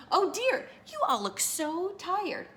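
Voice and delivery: high, drawn-out false sympathy; mock-concerned fox. A narrator's reading. Fox coaxing tired birds to his den, sugary concern.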